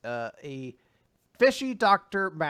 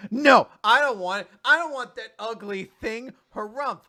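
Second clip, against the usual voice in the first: a hint of a pouting girl, higher and louder; huffy refusal; 8 semitones higher, 9 dB louder than usual. Retelling the girl refusing the plushie, lightly voiced pout. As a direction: huff it like a sulky tsundere. Acting the tsundere girl's refusal.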